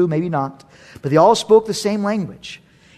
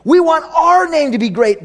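proud builders; 10 semitones higher, 5 dB louder than usual. Acted. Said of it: "they're saying".

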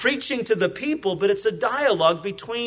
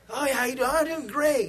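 mock cheerful; 5 semitones higher, faster than usual. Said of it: "so often we're that way".

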